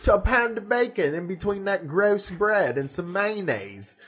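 Aussie bloke defending his bacon; mock Australian accent. Imitating Tea Party Aussie about bacon, Australian accent. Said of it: "Did I do a good Australian accent first?".

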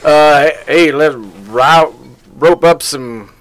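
hammed-up Texas cowboy accent; Texan accent. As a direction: play a jokey Texan cowboy. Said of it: "That was Texan".

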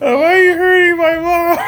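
high, loud mock-wailing kid; crying drunk kid. Retelling walking in on parents, high mock wail. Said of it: "I was crying and shit".

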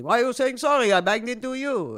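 loud, high indignant yell; yelling Israeli. Retold or imitated. Stranger in the street yelling loudly at an apologizing Canadian. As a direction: yell it like a stranger who got bumped. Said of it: "he yells at me".

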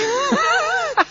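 a high laugh breaking out; high burst of laughter. Real, not acted. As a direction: laugh at the awkward moment. Laughs at the mishap.

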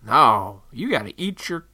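ordinary talk

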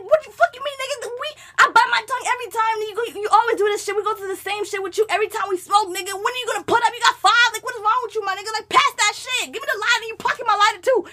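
high, fast, exasperated rant; retold cursing out. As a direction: reenact cursing out a friend in a rush. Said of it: "still cursing out".

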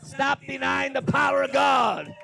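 loud, slow, drawn-out sermon cry; preacher's shout. Real, not acted. Preacher commands the church after Peter's denial story, shouting.

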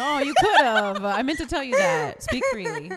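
giggling through the words; laughing her encouragement to the guest. Real, not acted. Host laughing at guest's joke.

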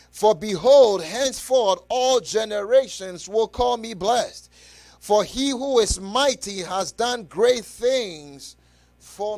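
loud, slow proclaimed reading; fervent scripture reading. Acted. Preacher reads Mary's song to church, loud and slow. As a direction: declaim the Song of Mary.

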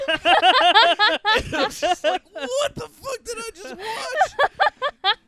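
very slow, high, laughing; slow high laughing disbelief. Real, not acted. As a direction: stretch it out in stunned giggles.

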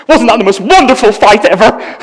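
high, loud gushing question; beaming delight. Retold or imitated. Boyfriend returns to Phoebe delighted by the fight. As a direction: gush about the fight, delighted. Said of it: "he says".

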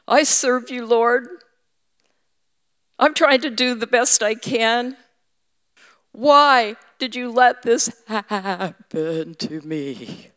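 high whining plea, slowing to a moan at the end; whiner. Retold or imitated. Preacher pleading with the Lord in a mock whine. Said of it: "I can be a whiner with God".

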